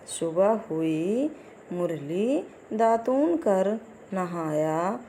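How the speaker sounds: slow, careful reading of the sentence. Shown as a demonstration. Teacher reading a sentence slowly for children to follow. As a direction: read it slowly, word by word. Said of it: "तो आइए देखते हैं".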